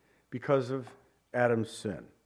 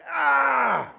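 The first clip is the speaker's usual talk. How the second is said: high, loud cry of frustration; frustrated shout; 11 semitones higher, 5 dB louder than usual. Acted. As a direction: let out a loud anguished "Ah". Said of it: "You can just feel the frustration".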